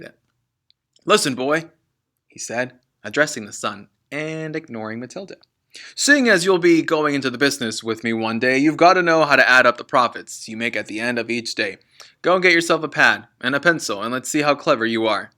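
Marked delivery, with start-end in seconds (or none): 1.1-1.7 loud commanding dad voice; bossy father. Acted. Father ordering his son to listen. 5.9-15.3 booming, fast orders; loud bossy father. Acted. Father lecturing his son about the business.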